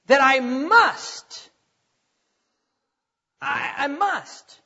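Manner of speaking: "must" drawn out very slowly with pauses; very slow earnest stress on "must"; slow emphasis. Real, not acted. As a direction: dwell on "must" as if weighing it. Stressing the key word.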